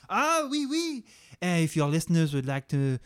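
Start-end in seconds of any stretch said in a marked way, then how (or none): 0.1-1.0 high, chirpy fake French oui; mock French. Chef answers the sous chef in fake French. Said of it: "broken French accent".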